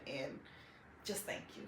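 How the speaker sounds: soft, low, barely voiced thanks; holding back emotion. Real, not acted. Daughter thanking the hair clinic owner, holding back emotion. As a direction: hold it in as the voice drops away. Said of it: "I heard in her voice she was trying to hold it".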